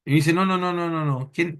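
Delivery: usual speaking voice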